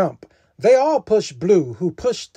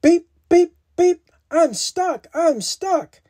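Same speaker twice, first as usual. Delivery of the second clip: high beeping alarm; high, pleading little truck; little truck's cry; 9 semitones higher than usual. A narrator's reading. Little Blue Truck stuck in mud, high cry. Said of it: "cried the Little Blue Truck".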